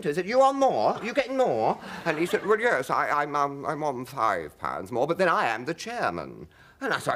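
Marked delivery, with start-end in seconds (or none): 0.3-1.8 high, loud, outraged question; indignant challenge. Retold or imitated. Confronting Parsons about his higher fee, indignant and loud. 2.5-6.5 hint of smug chairman. Chairman explaining his higher pay, lightly voiced smug.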